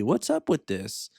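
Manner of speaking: a light, rising Seinfeld-style "what's the deal"; stand-up comic whine, pitch climbing to the deal; Jerry Seinfeld. Retold or imitated. Asking ChatGPT in a mild Seinfeld impression. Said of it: "That's my bad, uh, Jerry Seinfeld impression".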